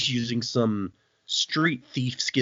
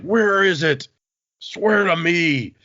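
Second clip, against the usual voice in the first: loud, higher, drawn-out mock demand; hammed-up demanding shout; 7 semitones higher, 5 dB louder, slower than usual. Villain grabs her and demands answers, hammed-up shout. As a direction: bellow it like an overblown villain interrogation.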